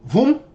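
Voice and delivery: high quick voom for a fast move; vocal whoosh. Retold or imitated. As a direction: sell the crossover with a whoosh. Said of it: "He does this".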